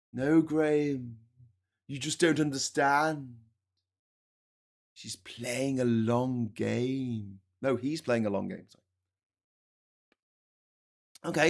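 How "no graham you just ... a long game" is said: mock condescending reply. Party loyalist lecturing Graham, slow mock condescension. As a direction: talk down to him like a child. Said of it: "we were told that".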